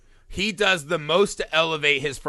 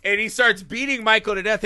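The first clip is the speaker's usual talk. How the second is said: ordinary talk, nothing marked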